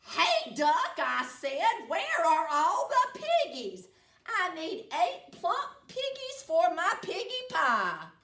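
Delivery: loud, high bossy witch voice; witch demanding. Acted. Witch demanding piggies from the duck, loud and bossy. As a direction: boss the duck around, witch style.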